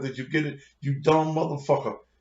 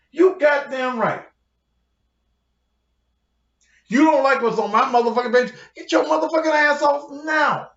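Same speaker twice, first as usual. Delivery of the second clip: building to a higher, louder shout; angry shouting; 7 semitones higher, 4 dB louder than usual. Real, not acted. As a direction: throw them off the page, yelling. Furious rant at commenters.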